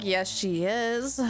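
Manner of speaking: usual speaking voice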